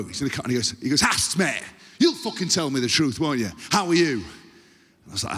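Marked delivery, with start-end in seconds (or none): 1.0-4.2 mock-harsh northern coach, raised pitch. Coach singling out a player, harsh demand. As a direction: demand the truth, forceful and matey. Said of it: "it's always just so harsh".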